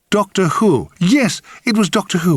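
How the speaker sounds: high, seizing on the answer; eager guessing. A narrator's reading. Narrator guesses how he knows the woman, eagerly. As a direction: pounce on a guess with sudden certainty. Desperate guessing at who she is.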